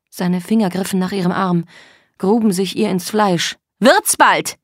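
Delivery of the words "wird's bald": high, loud burst; impatient bark. Acted. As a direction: bark at them to hurry. Er drängt drohend. (ungeduldig angeschrien)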